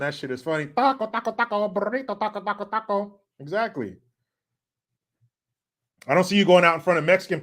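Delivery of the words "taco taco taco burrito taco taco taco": high, sing-song cartoon hand-puppet voice. Host recalls the funny J-Lo hand bit, sing-song cartoon impression. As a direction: chant it lightly in a pitched-up cartoon voice. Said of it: "Cartman with the [name] hand".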